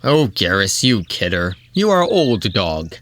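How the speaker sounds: mildly chummy hero's banter; palling around. A narrator's reading. Garfield jokes back with his pal Garrus, chummy. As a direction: rib a buddy, lightly acted. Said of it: "Garfield said with palling around".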